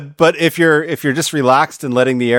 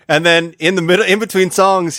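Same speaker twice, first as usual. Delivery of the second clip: ordinary talk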